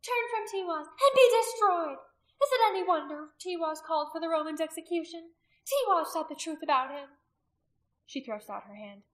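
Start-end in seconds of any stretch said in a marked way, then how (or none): 0.0-7.2 high, loud warning of doom; crying out. A narrator's reading. Priestess threatening those who turn from Tiwaz, crying out. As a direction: preach the threat forcefully. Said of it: "she cried out".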